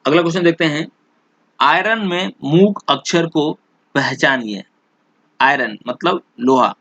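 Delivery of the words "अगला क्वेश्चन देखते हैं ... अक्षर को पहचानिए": normal talking voice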